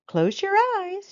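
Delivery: high, loud soothing instruction; a therapist coaxing the girl to relax. Acted. Therapist calming the sick girl, high instructing voice.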